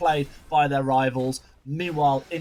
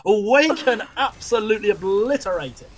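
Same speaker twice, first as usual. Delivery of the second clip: excited; 6 semitones higher, 5 dB louder than usual. Real, not acted. Caster reacts to big kill.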